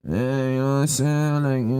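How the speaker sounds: mock mumbled street slang; mumbled slang imitation. Mocking how they talk on the panel, mumbled slang imitation. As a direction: slur the filler phrases, mimicking borrowed slang. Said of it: "Why you mumbling?".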